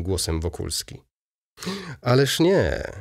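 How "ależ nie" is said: higher, exclaimed; exclaiming. A narrator's reading. Baron protesting to Wokulski, exclaiming. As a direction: cry it out, taken aback. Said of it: "zawołał baron, cofając się".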